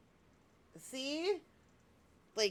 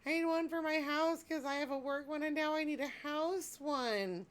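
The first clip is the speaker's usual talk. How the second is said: high, pleading voice, a little louder; playful mock whine; mock needy voice; 7 semitones higher, 4 dB louder than usual. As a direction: whine that you need another. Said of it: "I was like".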